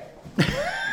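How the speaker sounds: high burst of laughter; real laugh. Real, not acted. Amused by someone in the group.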